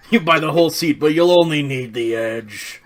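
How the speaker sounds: loud booming announcer; monster truck ad. Pretend. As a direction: sell it hard like an arena ad. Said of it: "like a monster truck commercial".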